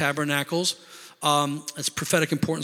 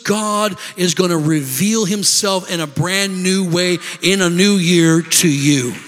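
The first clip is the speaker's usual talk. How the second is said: louder preaching that builds to a big finish; rousing preaching; 4 dB louder than usual. Real, not acted. Preacher promising the congregation a fresh word, rousing and building. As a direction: drive it home, stretching the key words at the climax.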